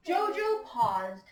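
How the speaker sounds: slow, emphatic storytime reading with swinging pitch; story read-aloud to children. A narrator's reading. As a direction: read it to a class of young listeners. Reads picture book to class.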